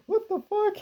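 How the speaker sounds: high, squeaky shocked question; shocked high squeak. Retold or imitated. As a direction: squeal it in disbelief. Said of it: "and I'm like".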